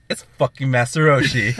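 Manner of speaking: laughing through the name in disbelief. Retold or imitated. Telling friends he found his lost keychain, laughing. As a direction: giggle out the discovery.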